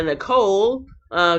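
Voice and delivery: ordinary talk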